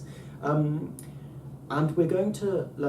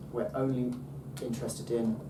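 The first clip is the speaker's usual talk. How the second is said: ordinary talk, nothing marked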